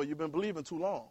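ordinary talk, nothing marked

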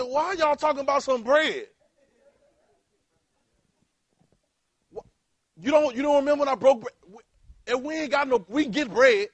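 loud, high, exasperated rebuke; Jesus scolding his disciples about bread, loud and exasperated. Acted. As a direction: scold the disciples for missing the point, loudly.

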